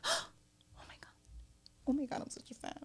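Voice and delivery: gasping, high and hushed fangirl imitation; breathless fan. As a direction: mimic being quietly starstruck.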